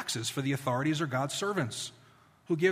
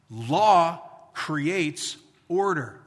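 slow, weighty maxim; 4 dB louder, much slower than usual. Acted. Sermon's key maxim.

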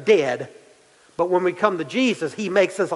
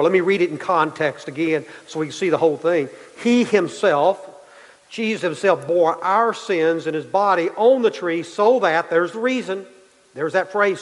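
ordinary talk, nothing marked

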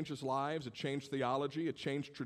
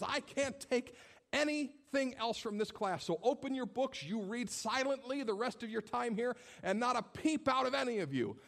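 teacher yelling; 10 semitones higher than usual. Retold or imitated. Teacher scolding her unruly eleventh grade class, exasperated high voice. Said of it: "she is yelling at us".

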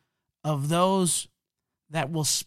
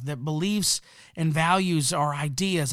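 ordinary talk, nothing marked

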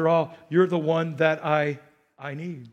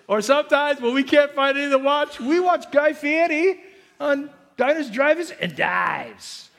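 excited riff; 8 semitones higher, 4 dB louder than usual. Acted.

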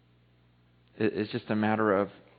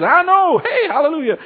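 very high, loud acted exclamation; imitated hallelujah shout, pitched way up; jubilant retold reply; 11 dB louder than usual. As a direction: cry it out like someone rejoicing in church. Acts out woman's joyful reply.

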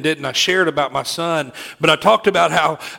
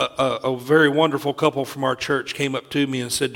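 normal talking voice

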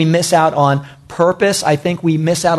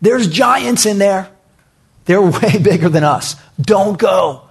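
alarmed warning; 3 semitones higher than usual. Retold or imitated. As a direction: imitate scared spies warning everyone back. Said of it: "some came out and said".

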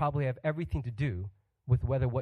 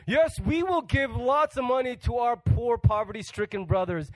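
grand pledge; 7 semitones higher, 3 dB louder than usual. Retold or imitated. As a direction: announce the pledge with pomp. Corinthians grandly promising.

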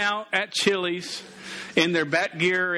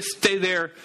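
normal talking voice

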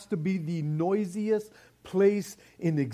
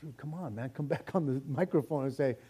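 mild mock impatience, muttered much softer; impatient mutter; 4 semitones lower, 8 dB quieter than usual. Preacher voicing a restless listener during the silence, soft mock grumble. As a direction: grumble under your breath for it to end. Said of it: "it's like".

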